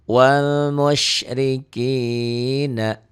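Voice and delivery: slow tajwid chant; Quran recitation. Shown as a demonstration. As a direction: draw the words out like a reciter. Recitation example. (lantunan tartil pelan)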